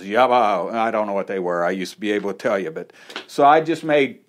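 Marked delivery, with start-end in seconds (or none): 0.0-0.7 "Yabba" said low and short, two quick syllables; tongues syllables demo. Shown as a demonstration. Preacher shows the congregation his first two syllables, low and short "Yabba". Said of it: "it's like I had two syllables".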